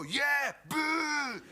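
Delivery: high, loud mock jeer; crowd shouting boo. Crowd answering the comic, loud mock jeer. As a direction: yell it back like the crowd.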